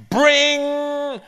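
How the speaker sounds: high loud ring sound; doorbell ring. Acted. Narrator imitating the doorbell, high and loud.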